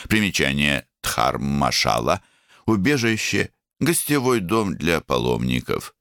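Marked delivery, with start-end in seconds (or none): none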